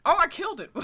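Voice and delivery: high, loud shocked cry; surprised yelp. Real, not acted. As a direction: blurt it out in alarm. Accidentally kills Pokemon.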